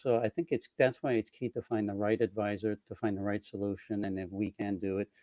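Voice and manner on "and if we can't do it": ordinary delivery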